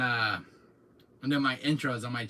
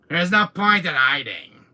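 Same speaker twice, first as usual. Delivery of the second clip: villain taunt; 4 semitones higher, 11 dB louder than usual. Retold or imitated. Villain lines in Wesker bit.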